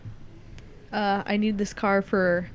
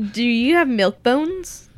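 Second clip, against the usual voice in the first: louder, slower, higher repeat; 5 semitones higher, 7 dB louder, slower than usual. Acted. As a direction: ask again, louder and insistent.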